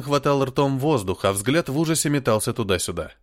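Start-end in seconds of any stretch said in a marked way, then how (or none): none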